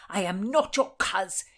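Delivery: forceful, loud "not your coz". Acted. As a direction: hammer the denial home.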